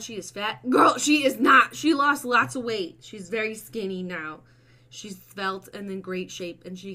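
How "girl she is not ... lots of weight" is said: much louder, higher protest; indignant loud retort. Real, not acted. Owner defending her dog from a fat comment, indignant. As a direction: shut the comment down loudly.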